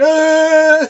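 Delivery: high startled yell; mock yelp. Optimus shooting off with thrusters, startled yelp. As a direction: yelp as you take off. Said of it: "he's like".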